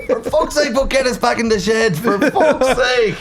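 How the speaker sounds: high exasperated mock complaint. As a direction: whine it in high mock frustration.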